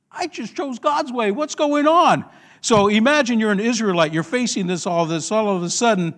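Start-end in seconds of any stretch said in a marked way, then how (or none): 0.1-2.2 high, alarmed outcry; panicked protest. Acted. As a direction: cry out like someone cornered. Voices panicking Israelite trapped by sea.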